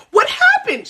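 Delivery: high-pitched imitated distress; emotional neighbor. Neighbor questioning the cut, imitated emotional cry. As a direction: demand it in a very high, upset voice. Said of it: "She's emotional".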